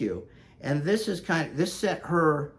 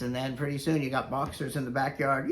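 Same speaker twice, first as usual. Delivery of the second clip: ordinary talk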